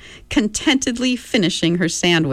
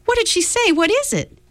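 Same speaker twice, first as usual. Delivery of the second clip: very high, panicky questions; pleading in alarm; high frightened girl. Acted. Meg frightened, questioning mother.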